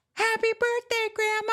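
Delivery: very high, squealed birthday cheer; excited cheer. Retold or imitated. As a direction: shriek it like the whole family at once. Family shouting at the surprise party.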